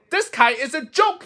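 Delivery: high, whiny giving-up voice; a fed-up little toad, high and whining; despairing Toad. Acted. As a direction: whine like you've had enough. Toad repeats robins' jeer.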